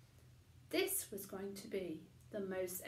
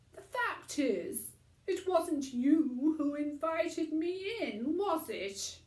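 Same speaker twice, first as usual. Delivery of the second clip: higher, louder pointed question; Mister Stink exposing her; 8 semitones higher, 11 dB louder than usual. A narrator's reading. Tramp exposing Missus Crumb's lie to her face, higher and louder. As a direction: lay the truth bare.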